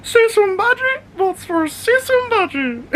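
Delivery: very high squeaky voice breaking into laughter; laughing high dog voice. Pretend. Owner repeats his favourite dog-voice line, very high and laughing. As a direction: squeak it while cracking up. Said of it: "I'm like".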